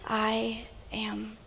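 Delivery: very soft, halting near-whisper; hushed words from the cross; dying words. Acted. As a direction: break it up with a long pause, fading away. Jesus, arms outstretched, dying words.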